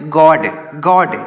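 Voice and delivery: गॉड, गॉड said slowly with the open aw vowel; slow model pronunciation. Shown as a demonstration. Teacher slowly modelling गॉड for children with open aw. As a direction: draw out गॉड twice, in-between o and aa. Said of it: "इस तरीके से पढ़ेंगे".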